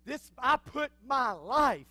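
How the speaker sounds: swelling from strong to a loud shout; building preaching. Acted.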